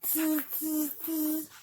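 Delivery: high-pitched hissy "tz" monkey sounds; monkey chatter. A narrator's reading. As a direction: mimic mocking monkeys, tz by tz. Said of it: "monkeys only stamped both their feet back at him".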